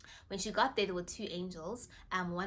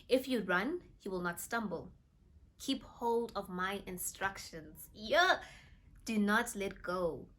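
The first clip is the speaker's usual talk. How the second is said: scripture reading; 4 dB louder than usual. Acted. Vlogger reading Proverbs to her viewers, solemn reading. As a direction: read the verses like sacred text.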